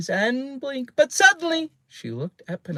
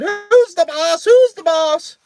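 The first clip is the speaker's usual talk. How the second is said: loud, high, drawn-out squawk; defiant parrot challenge; 10 dB louder than usual. A narrator's reading. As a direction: screech it like a bossy bird. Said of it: "and then he said".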